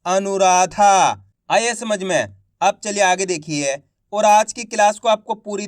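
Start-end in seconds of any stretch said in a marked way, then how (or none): 0.0-1.2 Anuradha said whole, slowly and clearly; careful teaching read of Anuradha; slow blended word. Shown as a demonstration. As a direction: join the sounds into Anuradha. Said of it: "तो मिलके बन जाएगा".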